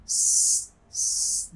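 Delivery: hissed unvoiced s sound; sharp safir hiss without voice; whistling s. Shown as a demonstration. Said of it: "Ada safir".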